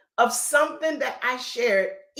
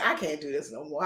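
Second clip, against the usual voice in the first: much softer and lower, fed up and defeated; worn-out sigh; 9 semitones lower, 7 dB quieter than usual. Retold or imitated. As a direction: let it sag out of you, spent. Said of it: "I said".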